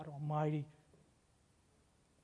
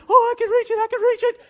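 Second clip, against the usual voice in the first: much higher, louder, breaking into a laugh; hammed-up reaching cry, laughing; Elsa straining; 15 dB louder, faster than usual. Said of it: "She goes".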